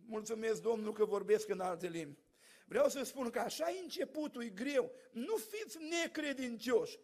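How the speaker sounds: ordinary talk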